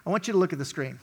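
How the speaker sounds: usual speaking voice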